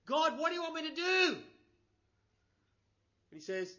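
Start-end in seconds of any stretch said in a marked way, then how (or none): 0.0-1.3 high, loud pleading call; guy calling to God. Acted. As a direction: call up to God for instructions while hanging on. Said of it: "And the guy says".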